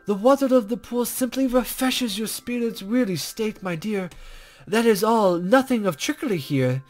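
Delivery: a hint of a coy, teasing sage; lightly voiced mysterious guide.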